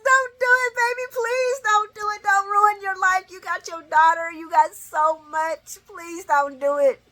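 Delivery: high, loud pleading that eases slightly as it goes; screaming plea. Retold or imitated. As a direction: beg desperately at full voice. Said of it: "I am screaming".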